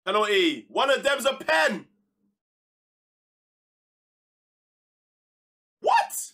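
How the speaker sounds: loud, high shocked shout; can't believe the referee; outraged disbelief. Real, not acted. Gamer watching his player clattered in the box, outraged shout. Outraged at penalty not given.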